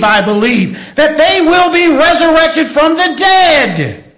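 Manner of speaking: rising, loud preacher's cry; fervent graveside sermon cry; preaching at full force. Acted. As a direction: preach it out over the congregation.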